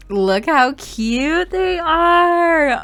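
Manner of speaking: high, slow, doting coo; gushing aww. Real, not acted. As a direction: melt over how cute they are. Gushing over dancing Sims.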